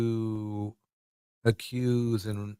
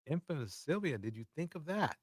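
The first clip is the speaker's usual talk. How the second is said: quiet mocking; 2 semitones higher, 16 dB quieter than usual. Real, not acted.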